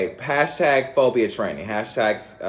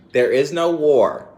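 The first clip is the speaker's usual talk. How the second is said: emphatic; 6 semitones higher, 8 dB louder than usual. Acted. Emphatic slow proclamation.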